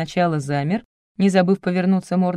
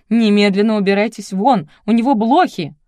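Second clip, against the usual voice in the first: loud; 3 semitones higher, 7 dB louder than usual. A narrator's reading. Shop owner throws photographer out.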